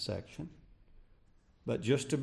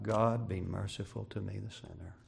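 humble plea; 4 semitones lower, 7 dB quieter than usual. Acted. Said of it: "he cried".

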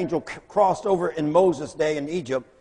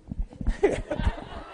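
breathless laugh; 12 semitones higher, 5 dB quieter than usual. Retold or imitated. Walter reacting to the man.

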